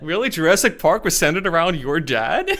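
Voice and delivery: laughing disbelief. Real, not acted. Laughing at an absurd claim.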